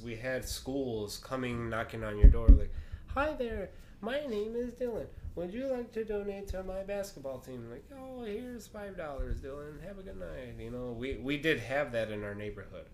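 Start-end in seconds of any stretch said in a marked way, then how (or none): 3.1-7.7 put-on high, polite little-kid sales pitch; Dylan. Boy asking neighbors for donations, high polite kid voice. 8.0-10.5 high, soft, sweet reply; kindly indulgent. Retold or imitated. Neighbor handing a boy a donation, soft high kindly voice.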